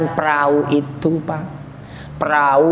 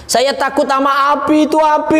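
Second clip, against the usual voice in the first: high-pitched mock sobbing plea; imitated crying; 7 semitones higher than usual. Soul afraid of the fire, acted tearful wail. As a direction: whine it as if crying from fear.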